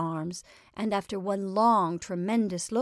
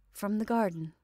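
ordinary delivery, nothing marked

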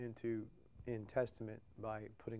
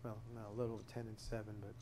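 earnest spoken prayer, soft and halting; 3 dB quieter than usual. Real, not acted. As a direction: pray it quietly, pausing between names. Closing prayer before Bible study.

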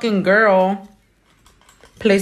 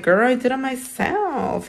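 higher, casual brag; proud reply; 6 semitones higher than usual. Pretend. Nail tech answering the admiring stranger, proudly. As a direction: toss it off proudly. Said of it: "you're gonna be like".

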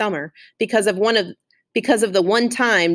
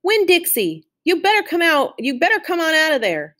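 hollering for the dog; 8 semitones higher than usual. Acted. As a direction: yell into the overgrown yard for your dog. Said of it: "went to the gate and hollered".

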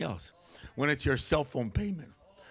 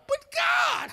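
pleading cry. Retold or imitated. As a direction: wail it up at God. Pleading with God in story.

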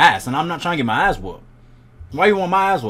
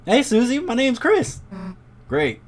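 ordinary talk

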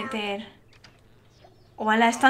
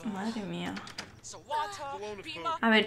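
ordinary talk

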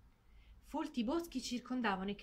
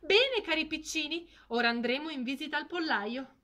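louder, higher, beaming pride; proud announcement; 7 semitones higher, 8 dB louder than usual. Acted. Mother duck proudly calling her ducklings to the henhouse. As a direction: call the chicks with pride. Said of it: "una nuova nota di orgoglio nella voce".

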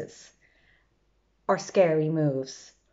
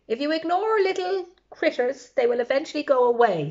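lofty dismissal; 7 semitones higher, 4 dB louder than usual. Acted. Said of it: "said Vladimir".

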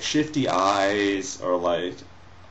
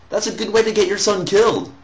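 higher and louder, indignant; mock outrage; 11 semitones higher, 5 dB louder, faster than usual. Real, not acted. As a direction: play up the outrage. Outraged at fictional mother.

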